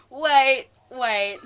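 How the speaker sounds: sudden loud wait. Real, not acted. Suddenly remembering a scene.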